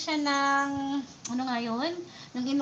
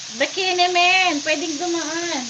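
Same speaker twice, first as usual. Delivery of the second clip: calling out; 5 semitones higher, 7 dB louder than usual. Real, not acted. Calling out to passing neighbors.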